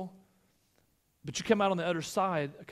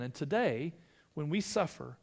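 ordinary talk, nothing marked

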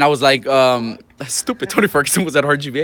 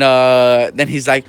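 normal talking voice

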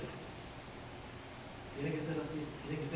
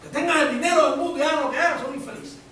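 preaching shout; 11 semitones higher, 11 dB louder than usual. Real, not acted. Preacher rising to emphasis.

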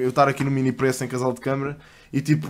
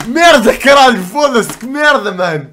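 high, loud shocked cursing; shocked outburst; 9 semitones higher, 13 dB louder, slower than usual. Real, not acted. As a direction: blurt it out stunned and loud. Reacting to a video. (palavrões aos gritos, em choque)

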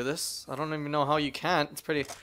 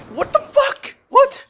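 high, loud shocked yelp; stunned screech at the screen; shocked yell; 10 dB louder than usual. Real, not acted. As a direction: blurt it out in disbelief. Stunned by game event.

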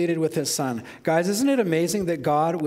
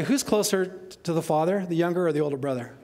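normal talking voice

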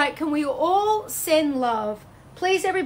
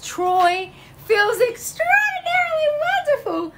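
high, gushing and emotional; gushing delight; 12 semitones higher, 4 dB louder than usual. Real, not acted. Host reading a viewer's comment, high and gushing. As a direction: swoon over the comment.

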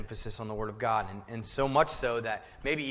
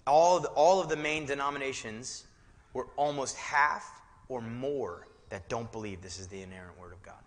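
usual speaking voice

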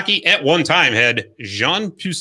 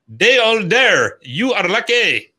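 slow, hammed-up French-Canadian accent, yelled a little higher; 6 semitones higher, slower than usual. Host doing the hockey clown's yell to the goalie in a mock French-Canadian accent. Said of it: "how's that for an accent".

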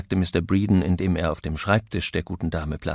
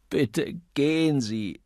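slow, raised, imploring voice; pleading; 6 semitones higher, slower than usual. A narrator's reading. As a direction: beg to be left alone. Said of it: "flehte Mister Copley".

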